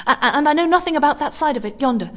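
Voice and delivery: high, fast, frantic pleading; rapid anxious pleading. A narrator's reading. Lone woman begging a stranger, high and frantic. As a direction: rush it, close to panic. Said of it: "more and more rapidly".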